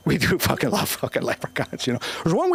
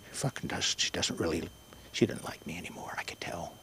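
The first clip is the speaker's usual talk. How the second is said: hushed secret whisper; 9 semitones lower, 13 dB quieter than usual. Real, not acted. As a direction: drop to a whisper as if she might hear. Sudden hushed aside.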